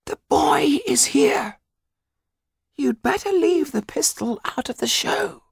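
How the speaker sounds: high, tense whispered dwarf voice; urgent dwarf Tetu. Acted. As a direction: keep it hushed so no one overhears. Tetu warns Topola urgently.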